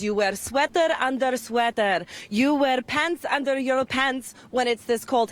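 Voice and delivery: put-on Polish-accented mother laying down advice; playful imitation of a Polish mama; Polish mama impression. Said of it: "I do impressions of my mom".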